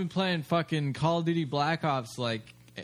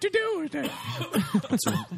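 much higher, slow, drawn-out talk-show voice with laughs; Oprah impression; slower than usual. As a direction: gush like a rich TV host in a high falsetto. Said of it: "Oprah, do Oprah".

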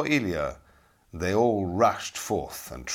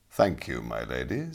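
low knight voice; 8 semitones lower than usual. Acted. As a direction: accept graciously. Ilya answers the lady.

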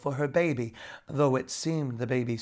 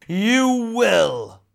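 furious royal roar, loud and high; 6 semitones higher, 9 dB louder than usual. A narrator's reading. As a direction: bellow the command in rage. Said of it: "roared the king".

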